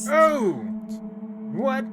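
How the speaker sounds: sudden high shout of shock; loud shocked cry; startled shout. Real, not acted. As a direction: jump out of your skin. Shocked at the window.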